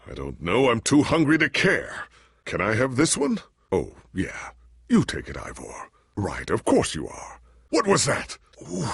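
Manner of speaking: normal talking voice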